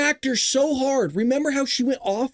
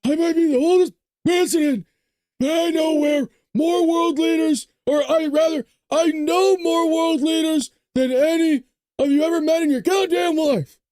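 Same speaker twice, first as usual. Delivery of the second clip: put-on higher and louder, yelling old president; Biden impression shouting; 7 semitones higher, 4 dB louder than usual. Host redoes Biden scolding reporters, angry shout. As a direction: bellow it like a furious old president. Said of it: "let me do, let me do it".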